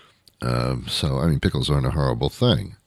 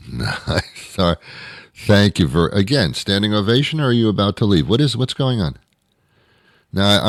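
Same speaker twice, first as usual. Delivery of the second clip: ordinary talk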